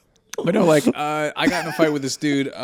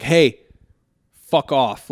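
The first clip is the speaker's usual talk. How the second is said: loud retold shout at the bully; confronting a huge bully in the cafeteria, loud shout; retold yell; 8 dB louder, much slower than usual. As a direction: bark it at him, louder. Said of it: "started screaming in his face".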